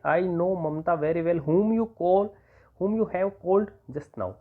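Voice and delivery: very slow, word by word; slow, spaced dictation of the sentence. Shown as a demonstration. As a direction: dictate it slowly for students to write. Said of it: "teacher dictating combined sentence".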